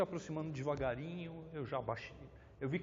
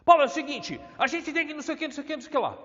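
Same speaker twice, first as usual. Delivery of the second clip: shouting furiously, much higher and louder; angry shout; 14 semitones higher, 11 dB louder, much faster than usual. Retold or imitated. Angry visitor yelling at Paulo, loud furious shout. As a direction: yell at him like an enraged visitor. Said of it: "uma pessoa chega muito brava pra falar comigo".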